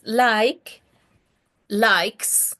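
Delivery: like then likes, the added s sounded clearly; teacher models like and likes; teaching the verb forms. Shown as a demonstration. Teacher modelling the verb forms for the class, careful. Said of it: "Sabemos que la conjugación es".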